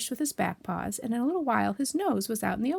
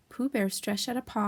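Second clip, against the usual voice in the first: usual speaking voice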